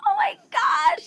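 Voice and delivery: very high, drawn-out marvelling; awestruck squeal. Retold or imitated. Host hearing the singer's sound check, awestruck. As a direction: stretch it out high, swooning. Awed reaction to his singing.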